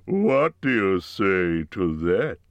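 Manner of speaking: fishing for praise; preening dragon. A narrator's reading. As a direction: dragon showing off his belly. Smaug showing off.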